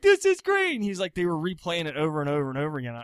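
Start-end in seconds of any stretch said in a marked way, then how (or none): 0.0-0.7 put-on much higher, louder kid gushing; delighted kid. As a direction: squeal it like a thrilled eight-year-old. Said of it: "And they're like".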